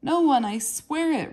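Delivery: high, loud terrified plea; boy crying out after being knocked down; frightened cry. A narrator's reading. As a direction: cry out in panic to stop the beating. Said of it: "Ranafir cried".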